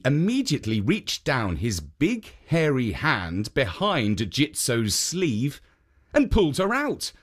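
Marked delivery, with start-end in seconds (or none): none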